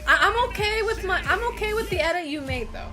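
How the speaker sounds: giggling through the words; laughing reassurance. Real, not acted. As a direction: laugh while reassuring the artist.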